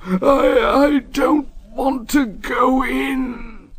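high, slow wailing plea; scared engine. A narrator's reading. Henry pleading with his driver, high and scared. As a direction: beg not to go in.

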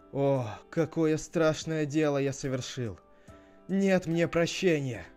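loud, high wail of remorse; anguished lament. Acted. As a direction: cry out in theatrical despair. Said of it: "бьёт себя кулаком в грудь и рыдает".

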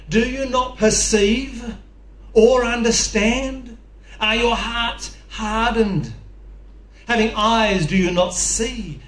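slow, pleading rebuke; drawn-out, exasperated teacher; exasperated Jesus. Retold or imitated. Jesus quoted rebuking disciples.

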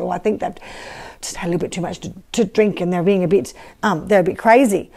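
low, dismissive mocking scoffers; scoffing. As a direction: scoff them off as drunk.

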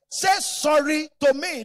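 stern demand. Retold or imitated. Father demanding apology, boy arguing.

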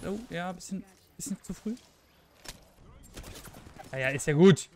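normal talking voice

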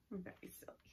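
soft breathy whisper. Real, not acted. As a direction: whisper it like a secret. Whispered aside to child.

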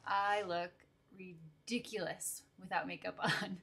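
ordinary delivery

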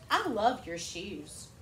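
slow, gentle compliment; slow warm compliment. Shown as a demonstration. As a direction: praise the child's shoes kindly and slowly. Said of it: "He just went".

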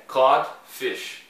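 codfish slow and loud, with no L sound; careful English pronunciation. Shown as a demonstration. Teacher warning students about codfish, slow and emphatic. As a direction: stretch out codfish, stressing cod.

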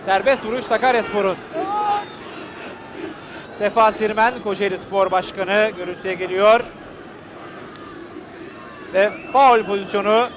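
ordinary delivery

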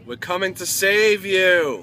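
calling out. Pretend. Calling out to the lost pet.

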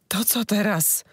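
high, rasping, squeezed-out question; rough, strained voice. Acted. Wife after being choked asks what now, rasping. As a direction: force it past a hurt throat. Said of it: "wydusiła kobieta szorstkim głosem".

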